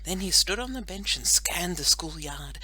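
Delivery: usual speaking voice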